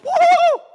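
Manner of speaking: high, loud celebratory whoop; acted shriek of joy; excited whoop. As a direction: whoop like a prize winner. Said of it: "get off the phone and go".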